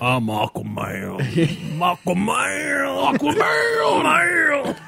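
slow, drawn-out commercial voice; beer ad Aquaman. Pretend. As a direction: stretch it like a beer jingle. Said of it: "spokesperson for Budweiser".

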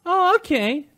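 put-on high, sweet girlfriend reply. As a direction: chirp it like a girlfriend. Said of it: "So it's like".

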